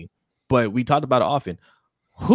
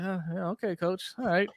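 higher, quiet reluctant voice; mock reluctant player; 10 semitones higher, 6 dB quieter than usual. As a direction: grudgingly go along with the coach. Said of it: "Even Lamar's kinda like".

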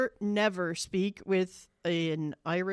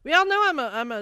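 excited; 8 semitones higher, 8 dB louder, much faster than usual. Real, not acted. Emphatic self-admission.

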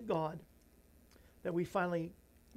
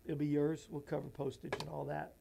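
normal talking voice

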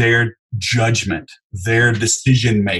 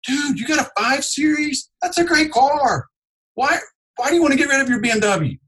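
much higher, upbeat buddy voice; friendly salesman impression; 13 semitones higher than usual. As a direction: compliment the car with bright enthusiasm. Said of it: "I'm like".

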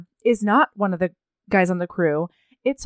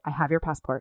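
quiet confession; 6 semitones lower, 7 dB quieter, faster than usual. Retold or imitated.

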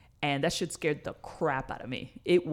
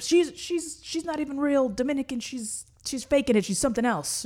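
high, louder mock of hateful commenters; 10 semitones higher, 5 dB louder than usual. Mocking the hate comments in a high voice. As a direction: sneer it in a shrill gossip voice. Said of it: "and all a bunch of".

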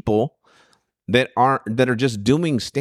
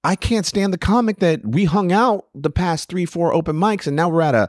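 high, ranting, half-joking outrage; mock indignant rant; 8 semitones higher than usual. Comic acts out his old rant at snubbing comics, high mock outrage. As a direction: vent like you're fed up, pitched high.

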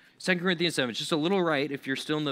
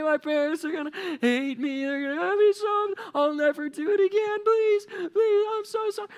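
shrieky-high hammed-up bawling; over-the-top mock crying; 4 dB louder than usual. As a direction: beg hysterically like a caught kid, absurdly overdone. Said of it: "think, like, double extreme".

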